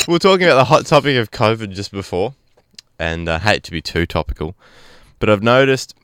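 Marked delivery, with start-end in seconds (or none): none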